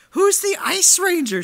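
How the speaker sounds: put-on high, excited child's question; little girl voice. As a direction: voice the young daughter asking. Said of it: "she's like".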